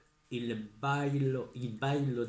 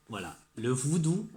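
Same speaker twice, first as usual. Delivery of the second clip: ordinary talk, nothing marked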